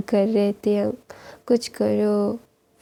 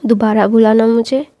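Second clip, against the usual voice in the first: a touch of mock anger, louder at the end; retold angry big brother, louder on the last words; glaring threat; 4 dB louder than usual. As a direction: glare and dare him to repeat it. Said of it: "बड़ी-बड़ी आंखें दिखा के बोलता है".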